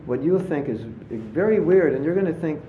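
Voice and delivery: ordinary talk, nothing marked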